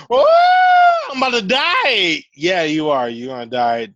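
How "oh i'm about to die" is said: high, loud hammed-up wail; mock dying wail. Mock dying drug user.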